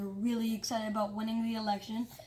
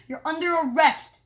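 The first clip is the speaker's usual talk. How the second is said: loud, higher officer's command; firm arrest; 5 semitones higher, 10 dB louder than usual. Acted. Security guard cuffing the mayor, loud command. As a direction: bark out the arrest.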